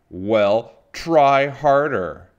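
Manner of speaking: loud, drawn-out scolding; screaming. A narrator's reading. Mother Witch scolds Little Witch for not being mean, screaming. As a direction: raise the voice and stretch out the order. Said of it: "screamed Mother Witch".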